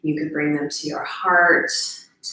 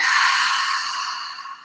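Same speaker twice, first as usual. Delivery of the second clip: long breathy sigh out; demonstrated sigh; 3 dB quieter than usual. Shown as a demonstration. Guide showing how to sigh it out, long sigh. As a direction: let all the air go in one long audible exhale. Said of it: "sigh it out".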